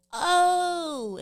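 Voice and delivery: high loud retold "oh" of realization; mock surprised oh. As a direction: imitate people suddenly getting it. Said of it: "that's when they're like".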